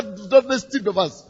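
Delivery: usual speaking voice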